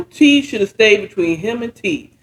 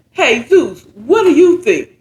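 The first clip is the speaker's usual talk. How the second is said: higher, lightly voiced associate with a laugh in it; associate asking; 7 semitones higher than usual. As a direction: toss Zeus the question casually, half laughing. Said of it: "one of the associates said".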